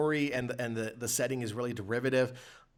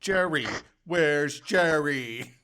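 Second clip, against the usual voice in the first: slow, loud falsetto calling for Jerry; mock game voice; 2 semitones higher, 5 dB louder, much slower than usual. Mocking the game's characters, slow loud plea. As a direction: ham up the searching mother. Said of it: "this is how people in that game talk".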